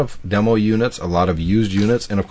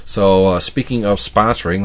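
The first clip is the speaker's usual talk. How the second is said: ordinary talk, nothing marked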